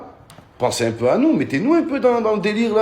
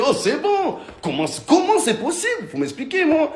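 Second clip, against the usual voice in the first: exasperated rant; 13 semitones higher than usual. Real, not acted.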